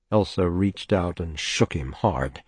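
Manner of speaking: ordinary delivery, nothing marked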